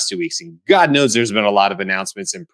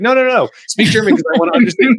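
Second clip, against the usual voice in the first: very high-pitched, insistent plea; mock desperate protest; 14 semitones higher, 4 dB louder, faster than usual. Friend insisting to locals on German, mock desperate. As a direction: repeat the no's in a high, hammed-up protest. Acted standoff over speaking German.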